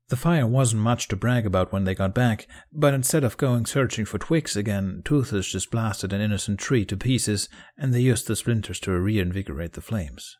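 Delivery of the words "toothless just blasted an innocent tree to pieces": usual speaking voice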